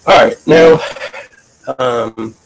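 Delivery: garbled, glitchy audio; scrambled voice. Real, not acted. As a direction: voice breaking up over a bad connection. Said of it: "I don't know why my voice is garbled".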